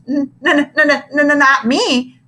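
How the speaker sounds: stuttering in fright; a frightened stutter; scared stutter. A narrator's reading. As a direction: stammer your denial nervously. Scared kid stuttering denial.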